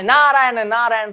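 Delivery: high chanted call, loud at the start; Narad's arrival call. Acted. Sage coming back after some time, high chanted call. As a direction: announce yourself with the holy name.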